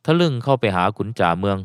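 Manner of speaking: usual speaking voice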